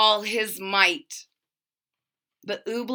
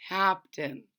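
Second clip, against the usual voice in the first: pleading; 3 semitones lower, 7 dB quieter than usual. A narrator's reading. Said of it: "pleaded Bartholomew".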